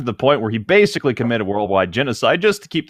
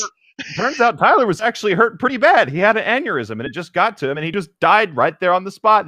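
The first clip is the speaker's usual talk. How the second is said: excited retelling; 9 semitones higher than usual. Real, not acted. Raised-pitch storytelling.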